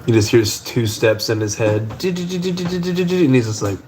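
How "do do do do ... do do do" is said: quick monotone run of little high do's; rapid mimicked stutter noise. Streamer mimics steps in a friend's head, rapid high patter.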